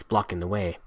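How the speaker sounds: ordinary delivery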